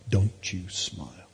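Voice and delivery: slow, low mock warning; mock-stern command. Dad daring his son, slow mock-stern. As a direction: draw out a playful prohibition.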